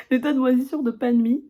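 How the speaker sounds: loud, high, laughing repeat; laughing disgust. Real, not acted. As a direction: crack up saying it.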